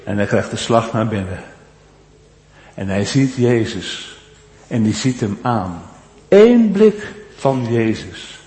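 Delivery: low and very soft, almost inward; preacher telling of Jesus' look at Peter, hushed and solemn; hushed awe. Acted. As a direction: sink the voice to a hush as Jesus looks. Hushed climax, Jesus looks at him.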